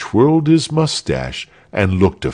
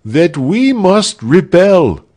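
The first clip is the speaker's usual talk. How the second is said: loud, high, slow proclamation; rousing declaration; 10 semitones higher, 5 dB louder, slower than usual. A narrator's reading. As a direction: declare it boldly after a deep breath. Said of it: "drawing a deep breath".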